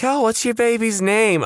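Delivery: high, bright mock clerk asking; mock official. Clerk asking a new mother, high and chirpy. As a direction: play a chirpy clerk at the desk.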